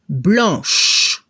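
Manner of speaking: blanche, finish on sh; final sh heard. Shown as a demonstration. Teacher correcting the quiz answer. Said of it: "se debe escuchar el sonido sh".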